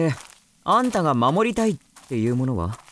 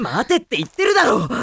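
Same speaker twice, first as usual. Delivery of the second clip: insistent shout; 9 semitones higher, 4 dB louder than usual. Acted. Demon stopping her.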